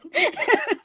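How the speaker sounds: short high laugh breaking out; high burst of laughter. Real, not acted. Cracking up at her own movie joke, high laughter. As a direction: let a high giggle escape.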